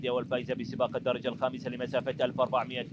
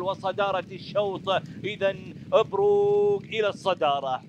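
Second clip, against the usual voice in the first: rising, louder call as horses battle; excited race call; 4 semitones higher, 5 dB louder than usual. Real, not acted. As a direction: build the excitement to the finish. Race climax, horses battling for lead.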